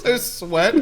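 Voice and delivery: laughing out the words; cracking up mid-line. Real, not acted. Laughing burst at the sweat.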